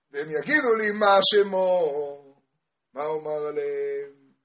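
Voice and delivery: slow, stretched-out chanting delivery; sing-song preaching. Retold or imitated. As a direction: hold the long notes like a learning chant. (דרשה בניגון איטי ומתמשך)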